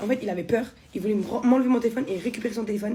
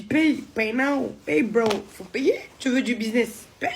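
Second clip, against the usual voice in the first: mock demand; 3 semitones higher, 4 dB louder, slower than usual. Demanding payment from the driver.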